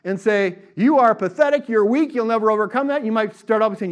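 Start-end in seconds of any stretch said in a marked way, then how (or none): 0.7-3.0 loud, high-pitched taunt; enemy hurling insults; yelling accuser. Acted. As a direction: yell it to put them down. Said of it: "He might still yell".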